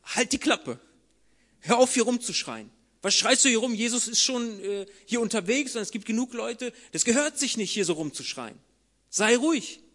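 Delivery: loud irritated scolding; annoyed bystanders. Acted. (genervt, laut zurechtweisend)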